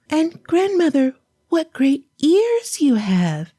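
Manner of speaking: put-on high, sweet child voice; a small girl calling grandmother; little girl voice.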